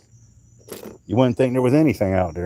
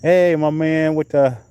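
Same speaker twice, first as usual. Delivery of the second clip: calling out; 8 semitones higher, 3 dB louder than usual. Real, not acted. Calling out greeting to passerby.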